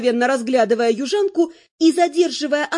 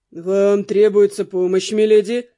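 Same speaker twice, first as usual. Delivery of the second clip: lower, slower, deferential butler voice; a butler politely offering help; courteous butler; 5 semitones lower, 4 dB louder, slower than usual. A narrator's reading. Butler offering help to his lady, low and courteous. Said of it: "предупредительно спросил дворецкий".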